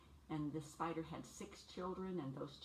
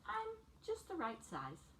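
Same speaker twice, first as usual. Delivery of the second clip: put-on much higher and slow, pleased small-boy voice; proud little boy; 12 semitones higher than usual. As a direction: say it like a kid who's just right. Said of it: "and he says".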